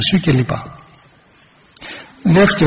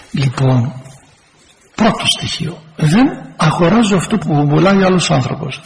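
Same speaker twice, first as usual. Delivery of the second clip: normal talking voice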